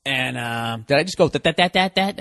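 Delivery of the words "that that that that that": imitating own stuck stammer; Porky Pig stutter. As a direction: replay the stuttered repetition, mocking yourself. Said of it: "I sound like fucking Porky Pig".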